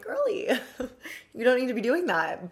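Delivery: put-on high squeaky teasing "Girly"; teasing self-scolding. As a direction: chide yourself playfully. Teasing herself about caffeine.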